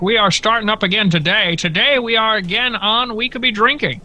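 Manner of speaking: high, loud announcer welcome; sportscaster voice. Pretend. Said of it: "You sounded like a sportscaster".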